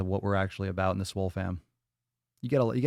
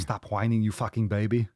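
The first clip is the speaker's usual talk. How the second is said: light Austrian accent, gruff mock scolding; Arnold voice. Streamer doing his Arnold voice at a whiner, a light Austrian accent. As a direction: play Arnold telling a crybaby off in an Austrian accent. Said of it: "But when I do the Arnold voice".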